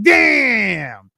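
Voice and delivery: long, high drawn-out exclamation; comically drawn-out mock disappointment; exaggerated dismay. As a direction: stretch it out high, sliding down. Retelling reaction to schedule clash.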